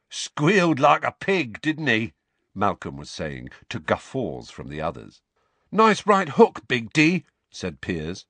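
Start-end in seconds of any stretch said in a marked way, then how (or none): 0.1-2.1 higher, louder voice of a bragging gang lad; jeering gang lad. A narrator's reading. Gang member jeering to guffaws. 5.7-7.2 high, loud cheer from a sidekick; raised voice of an eager hanger-on; admiring gang lad. A narrator's reading. Gang mate praising the bully.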